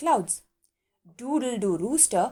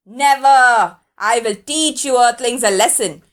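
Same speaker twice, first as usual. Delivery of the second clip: loud, booming declaration; proud sun yelling down; 8 dB louder than usual. A narrator's reading. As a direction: shout it at the earthlings. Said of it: "yelled the sun".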